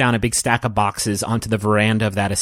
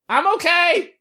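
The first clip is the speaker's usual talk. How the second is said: put-on very high young boy calling out; squeaky kid shout from far inside; kid yelling. Mookie calls out after a crash, very high kid voice. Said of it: "just yell".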